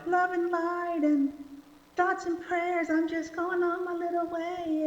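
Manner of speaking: high airy mock-spiritual voice; breezy dismissive. Preacher mocks an airy love-and-light attitude, high and breezy. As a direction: float past it lightly. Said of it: "So it's not like".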